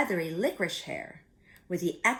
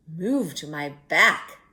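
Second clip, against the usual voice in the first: lower, slow sly fox voice; insisting fox; 4 semitones lower, 5 dB louder than usual. Acted. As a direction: coax her like a sly fox. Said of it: "he insisted".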